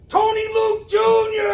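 high, loud showman's introduction; big announcement. Retold or imitated. Leo introducing his friend to Regis with a loud, high showman's call. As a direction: announce him like a game show host.

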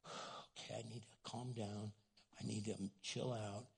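hushed, settling self-talk; calming down. Acted. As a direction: let a sigh out and talk yourself down softly. Upset person calming down, acted.

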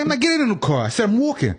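high-pitched, indignant protest; exasperated retold refusal. Walker retelling refusal to pestering cabbie, high exasperated protest.

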